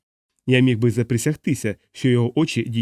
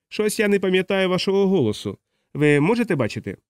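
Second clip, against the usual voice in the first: higher-pitched character voice, questioning; wary drunk stranger; 7 semitones higher than usual. A narrator's reading. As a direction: question an unknown visitor in a raised pitch. Stranger questions the unseen narrator. (насторожений п'яний незнайомець)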